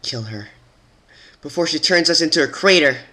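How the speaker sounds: mild snarl, rising to a loud high yell; snarling demand. Acted. Earth pony urging the others to kill Twilight, snarl rising to a yell. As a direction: snarl it, then push it up into a yell. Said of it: "let out a low snarl".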